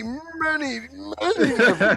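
laughing. Real, not acted. Rising laughing emphasis.

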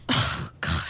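whispered exasperation, voice dropping away; hushed despair. Acted. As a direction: whisper it to yourself, defeated. Despair after unanswered call.

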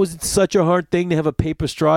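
ordinary talk, nothing marked

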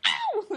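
very high squealed yelp; mock pained yelp. Imitating the character who gets hit, high mock yelp. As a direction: yelp as if suddenly hit, hammed-up. Acted cry after being hit.

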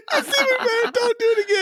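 high giggly pleading through laughter; laughing. Real, not acted. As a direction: laugh while begging him to stop. Giggling at repeated roll.

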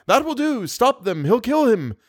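frantic onlookers' shouts; shouted. A narrator's reading. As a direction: call out to stop the fight. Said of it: "People in the house shouted".

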